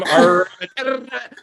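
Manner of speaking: put-on "rabbit" high, R drawn out in a thick accent. Learner answering his classmate's rabbit, exaggerated thick accent. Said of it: "I have a thick accent".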